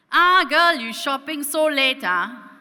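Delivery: loud, high Singaporean uncle voice; chatty cabbie teasing from the front seat; Singlish taxi uncle. Retold or imitated. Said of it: "he looks back and he says".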